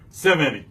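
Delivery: Seventy with SEV stressed, light short ending; stress on first syllable. Shown as a demonstration. Teacher repeating the example, stress on first syllable of Seventy. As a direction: stress the start of Seventy, let -ty drop. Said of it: "seventy se sou seven".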